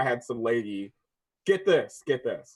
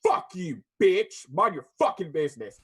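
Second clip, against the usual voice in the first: louder, higher retold swearing; retold angry retort; 5 semitones higher, 4 dB louder than usual. Worker telling off the accusing lady, louder and higher. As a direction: snap back at her, louder. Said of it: "I was like".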